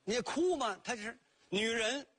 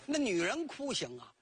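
ordinary delivery, nothing marked

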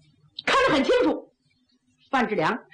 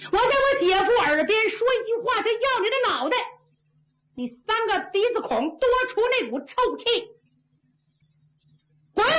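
high, blustering threats; arrogant threat; 10 semitones higher, faster than usual. Acted. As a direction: sneer at a superior and order him off. Officer threatens Zhu Yuanzhang. (高声嚣张威胁)

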